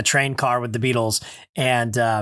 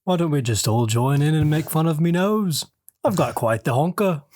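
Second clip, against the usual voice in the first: 1 Southern [name]; 3 semitones higher than usual. Retold or imitated. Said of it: "That was kinda Southern, sorry".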